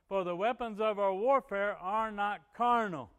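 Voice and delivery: loud, emphatic sermon delivery; forceful preaching. Real, not acted. Preacher expounding weapons of warfare, forceful preaching. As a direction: drive each point home from the pulpit.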